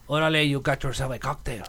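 put-on playful Mexican accent. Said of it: "your Mexican accent with orale".

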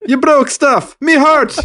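squeaky Irish lament, hammed up; mock Irish accent. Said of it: "You sounded Irish".

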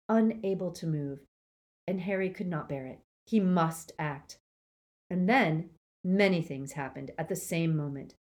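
ordinary delivery

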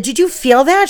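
high, loud eager question; excited question. Retold or imitated. Teacher asking the child about the fairy, high and eager. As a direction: ask it wide-eyed.

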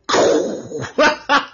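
a high, breathy giggle; high laugh. Real, not acted. Laughs at his own joke.

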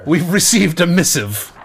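slow solemn storyteller voice; hushed creepy legend telling. Pretend. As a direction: announce it like an ancient tale. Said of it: "just like primitives around the campfire".

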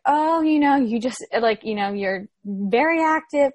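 mocking the doctor. Doctor's line mocked.

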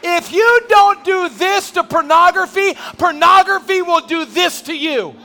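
high, loud, slow shouting sermon; preaching at full force. Real, not acted. Preacher warning church about pornography, full shout. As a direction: thunder it at them.

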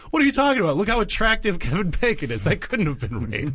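mock indignant protest. Comic disowning an absurd defence, mock indignant. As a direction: protest in hammed-up incredulity.

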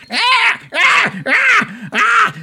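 short high-pitched screams in bursts; repeated startled goblin shrieks, very high. Acted. Goblin spots the body on the ground, screaming. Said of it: "He starts screaming".

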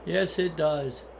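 usual speaking voice

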